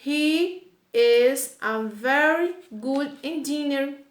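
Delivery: slow, careful English example; teacher modelling the English slowly; slow English model sentence. Shown as a demonstration.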